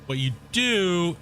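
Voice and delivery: slow, drawn-out Australian Irish imitation; Shane's Australian Irish voice. Imitating Shane explaining the Tim Tam Slam in Australian Irish. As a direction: mimic Shane's Australian Irish lilt, stretching the words. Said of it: "in his, in his Australian Irish voice".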